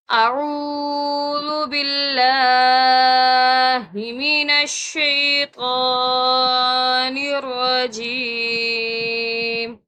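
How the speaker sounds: slow, chanted tajweed recitation; slow Quran chant; Quranic recitation. Acted. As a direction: recite it in long melodic lines. Begins recitation.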